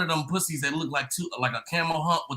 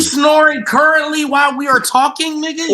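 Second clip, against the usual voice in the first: loud incredulous call-out; 10 semitones higher, 11 dB louder than usual. Real, not acted. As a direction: call him out loudly, in disbelief. Calling out snoring friend.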